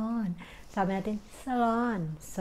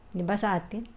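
normal talking voice

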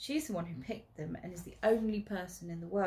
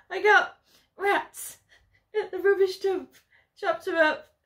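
crying with laughter; 12 semitones higher, 11 dB louder than usual. Acted. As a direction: laugh so hard the words barely come. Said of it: "crying with laughter".

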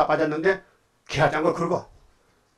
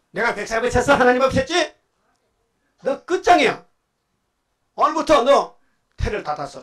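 higher and louder with each line, a mocking laugh in it; furious rebuke; 7 semitones higher, 5 dB louder than usual. Preacher voicing David shouting down his wife, rising fury. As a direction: build to a shouted, scornful verdict.

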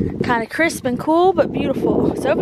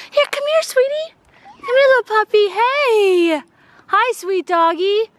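high, sweet puppy talk; 10 semitones higher than usual. Real, not acted. Mom coaxing a shy puppy closer, high baby talk.

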